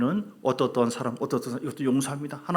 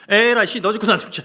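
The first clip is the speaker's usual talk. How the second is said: much louder and higher, reckless rage; furious outburst; 7 semitones higher, 9 dB louder than usual. Pretend. Preacher playing Joseph taking revenge, furious shout. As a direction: explode in desperate fury. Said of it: "이렇게".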